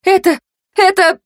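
high, faltering start; flustered stammer. A narrator's reading. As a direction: grope for the word, pitched high. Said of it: "обернулась к Данду и заткнулась". (растерянно запинаясь, высоко)

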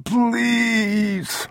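high drawn-out plea; calling out. A narrator's reading. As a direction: cry up the stairs begging. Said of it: "he called".

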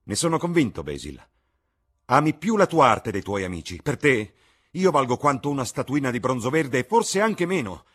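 resentful Dorian. A narrator's reading. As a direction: throw the bitterness at his friend.

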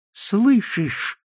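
high, startled exclamation; exclaiming. A narrator's reading. Trembling marquis hearing the ghost's words, exclaiming. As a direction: burst out on a high pitch. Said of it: "воскликнул маркиз".

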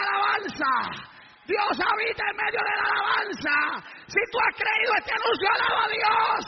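high, ringing shouted praise; full-force praise preaching. Real, not acted. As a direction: cry out urging the congregation to praise. (alabanza a gritos)

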